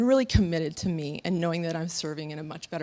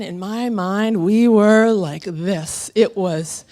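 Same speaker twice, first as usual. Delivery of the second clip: slow swelling awe; 9 dB louder, much slower than usual. Real, not acted. Describing gallop, slow and loud.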